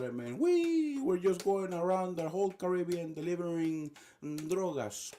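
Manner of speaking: high, gloating put-on voice; hammed-up high-pitched boasting; taunting trafficker. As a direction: brag and taunt in a mocking singsong. Acted regime voice taunting Americans.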